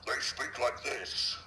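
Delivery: deep visceral voice from down in the throat; slow Sangheili growl, pushed from the throat; throaty alien. Shown as a demonstration. Showing an interviewer how Halo's alien Elites speak, throaty alien voice. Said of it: "deep visceral sort of down in your throat kind of sounds".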